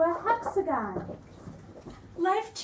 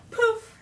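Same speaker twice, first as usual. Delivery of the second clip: higher, louder magic poof; 8 semitones higher, 6 dB louder than usual. Acted. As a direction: pop it out like a spell going off.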